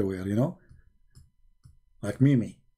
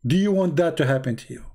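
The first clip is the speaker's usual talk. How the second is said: ordinary talk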